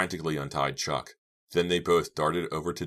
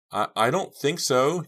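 higher, louder, faltering reply; shaky voice; 11 semitones higher, 7 dB louder than usual. A narrator's reading. Girl answering whether they can climb the stairs, shakily. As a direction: falter anxiously on a raised pitch. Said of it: "replied in a shaky voice".

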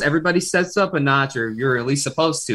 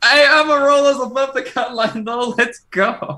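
excited cheer; 11 semitones higher than usual. Real, not acted. Cheering driver above cut.

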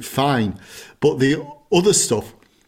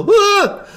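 high loud laughing squeal; startled yelp; 6 dB louder than usual. Retold or imitated. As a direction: squeal like your bum got grabbed. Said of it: "so I go".

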